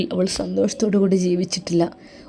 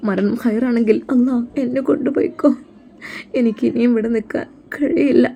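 higher, fading into a whisper; heartbroken prayer; 5 semitones higher than usual. Acted. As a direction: beg God in a breaking hush. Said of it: "മനം പൊട്ടിക്കൊണ്ട്".

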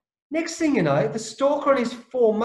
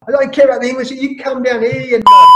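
loud, raised-pitch retold outburst; snapped angry shout; 5 semitones higher, 13 dB louder than usual. Player yelling back at an abusive heckler, angry shout. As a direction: shout it like you've just snapped. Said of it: "And then I just snapped".

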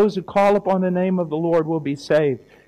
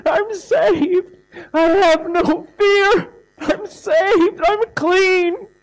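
much higher and louder, voice breaking with tears of joy; tearful joyful cry; 14 semitones higher, 6 dB louder, much slower than usual. Retold or imitated. Newly saved rancher telling the preacher he is saved, tearful ecstatic cry. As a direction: cry it out like a man overwhelmed with relief.

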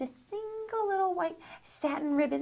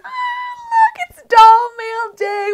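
excited; 12 dB louder, slower than usual. Real, not acted. Greeting excited over doll delivery.

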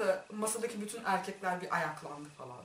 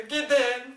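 "Gidin" louder, last syllable stretched; drawn-out plea; 6 dB louder than usual. Retold or imitated. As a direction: call out a long "Gidin" to shoo them. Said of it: "falan yapıyorum böyle". ("Gidin" sözünü yüksek sesle uzatarak)

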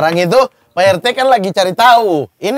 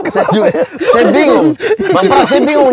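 higher and louder, laughing through it; breaking up mid-line; giggly confession of confusion; 8 semitones higher, 5 dB louder than usual. Real, not acted.

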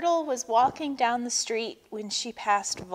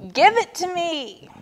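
high, loud demand; screamed; 8 semitones higher, 5 dB louder than usual. A narrator's reading. Turtle demanding the bracelet from Vulture, screaming. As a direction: scream it insistently. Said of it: "screamed Turtle".

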